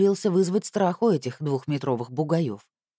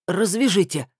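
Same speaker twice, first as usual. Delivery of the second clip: higher-pitched, firm order; commanding; 4 semitones higher, 4 dB louder than usual. A narrator's reading. Captive ordering his captors to untie him, commanding. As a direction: give it as an order, no pleading. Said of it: "а приказал Николай Иванович".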